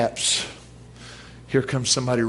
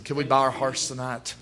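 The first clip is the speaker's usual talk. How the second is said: ordinary talk, nothing marked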